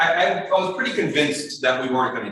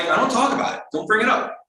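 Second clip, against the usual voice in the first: high-pitched combative retort; acted-out touchy refusal; 9 semitones higher than usual. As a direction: shut the question down defensively. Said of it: "I started getting combative".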